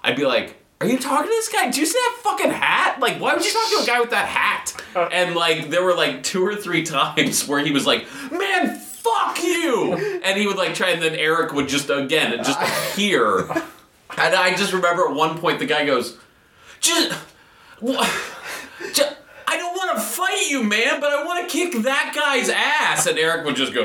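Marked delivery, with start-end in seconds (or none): 0.8-4.7 very high, mock-incredulous pestering; obnoxious heckling. Acts out himself loudly heckling stranger. 8.3-9.9 high, drawn-out mock shout; exasperated curse. Stranger explodes in retold quarrel. 16.8-23.1 high, halting mock fury; stammer, then burst out in helpless outrage; sputtering frustration. Stranger sputters at friend, retold.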